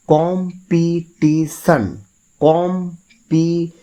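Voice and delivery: Competition slow and high, as কম, পি, টি, শন; level, drawn-out beats on competition with gaps between; four separate syllables. Shown as a demonstration. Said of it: "চারটে syllable আছে".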